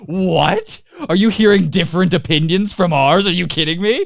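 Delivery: loud, high-pitched incredulous outburst; hammed-up shout of disbelief; mock outrage. Acted outraged reaction of others.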